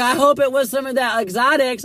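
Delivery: high, loud put-on voice; passerby teasing the arrested guy about his weed. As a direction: call it out with a cheeky grin.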